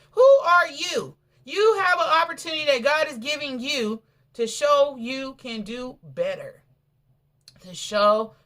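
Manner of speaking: high, loud, slow challenge; preaching climax. Real, not acted. Preacher challenging the congregation at full force. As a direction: demand an answer, full force.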